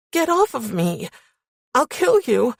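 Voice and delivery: high, unintimidating outburst; weak threat. A narrator's reading. Pinned woman threatening her captor, weak high threat. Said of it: "My voice was embarrassingly weak and unintimidating".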